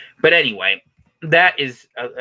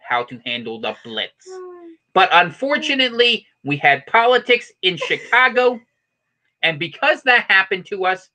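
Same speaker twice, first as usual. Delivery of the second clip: higher, punched announcer delivery with pauses; mock news report; 6 semitones higher than usual. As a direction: read it like a hammed-up local newscaster.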